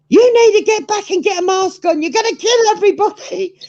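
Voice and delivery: high, loud scolding yell; shouting out the window. Retold or imitated. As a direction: bellow it like an angry old lady.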